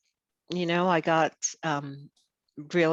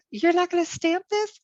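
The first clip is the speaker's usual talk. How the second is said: high, louder mock disbelief; incredulous question; 5 dB louder than usual. To the passport officer, mock disbelief. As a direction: act out the shock at the unstamped passport. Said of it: "It's like".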